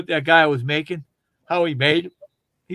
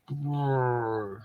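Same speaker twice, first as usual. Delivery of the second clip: mock fart noise; 4 semitones lower, 7 dB quieter than usual.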